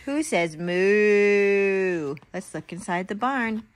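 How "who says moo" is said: drawn-out question. Acted. Parent reads farm book to toddler.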